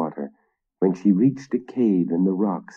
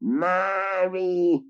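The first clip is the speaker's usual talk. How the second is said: mocking witch; 12 semitones higher, much slower than usual. A narrator's reading. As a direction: sneer it at him. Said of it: "mocked the witch with a dreadful laugh".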